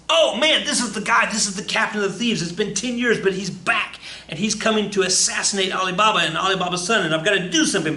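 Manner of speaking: high, loud panicked realization; alarmed realization. Acted. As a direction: gasp it out in sudden alarm. Morgana realizes the assassin.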